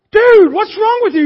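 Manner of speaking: high, loud, alarmed yell; shocked shout. Acted. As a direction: yell in disbelief at your buddy. Buddy shocked at shooting.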